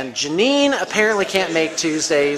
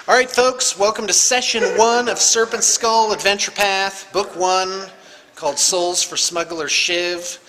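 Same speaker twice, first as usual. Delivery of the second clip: louder, higher show-host opening for the session; announcer welcome; 5 semitones higher, 4 dB louder than usual. Acted. Game master welcoming the table, hosting the show opening.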